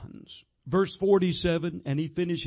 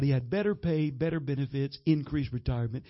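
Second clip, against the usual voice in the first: usual speaking voice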